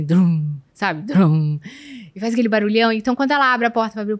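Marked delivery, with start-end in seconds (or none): 0.0-1.6 low booming mouth thump like car bass. Retold or imitated. To podcast host about neighbor's car, low booming bass imitation. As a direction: mimic the car stereo's deep boom. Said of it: "o carro faz assim".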